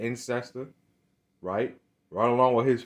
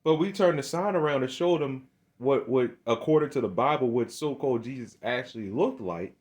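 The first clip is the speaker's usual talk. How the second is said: ordinary talk, nothing marked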